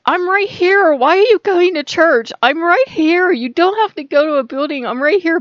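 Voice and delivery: high, loud, emphatic imagined voice; insistent pleading. Retold or imitated. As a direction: insist loudly that you are present. Voices Jesus pleading against church-going.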